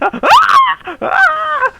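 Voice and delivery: a high, loud fake scream of pain, then dialed down; mock scream of agony. Shown as a demonstration. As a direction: shriek like your foot is being run over, hammed-up. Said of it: "fake screaming".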